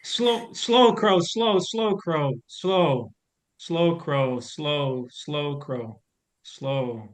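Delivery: calm the frightened bird down, word by word; soothing. Acted. Scarecrow calms panicking Crow.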